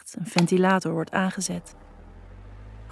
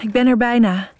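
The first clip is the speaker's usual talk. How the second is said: louder, breathless answer; panting; 4 semitones higher, 6 dB louder than usual. Acted. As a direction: say it still out of breath. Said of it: "hijg ik".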